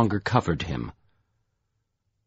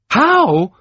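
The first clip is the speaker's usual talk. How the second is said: much higher and louder angry echo; fuming retort; 8 dB louder than usual. A narrator's reading. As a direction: throw the word back in fury. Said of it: "Troy fumed".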